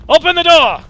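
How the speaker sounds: loud, high mock command; shouted command. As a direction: bellow it as a hammed-up order. Mock grand command in game.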